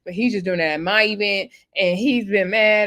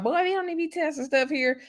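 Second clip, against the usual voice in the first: mock scolding; 7 semitones higher, 4 dB quieter than usual. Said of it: "She's like".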